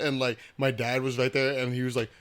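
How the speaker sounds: normal talking voice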